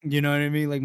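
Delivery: ordinary delivery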